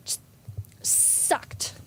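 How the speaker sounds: hushed, breathy dismissal; whispered sucked. Acted. Comic whisper, a cast member panning the Scrooge episode. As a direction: whisper the verdict like a secret.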